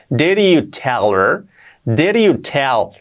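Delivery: slow "Did you tell" with tapped r, "deriu tel"; soft R link. Shown as a demonstration. Teacher building the phrase, slow soft-r link. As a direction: join "Did you" with a soft r, drawn out slowly. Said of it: "convierte en una R suave".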